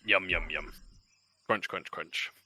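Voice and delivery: soft exaggerated eating noises; munch it quietly and greedily; mock munching.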